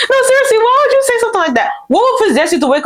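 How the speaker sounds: fast, very high incredulous squeal; high-pitched incredulity. Real, not acted. Reacting to a coworker's nosy question, incredulous.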